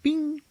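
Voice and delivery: very high, ringing bing; imitated phone chime. Storyteller mimics a dying cell phone, high chime. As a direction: sing out a tiny high electronic ping. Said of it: "It goes like".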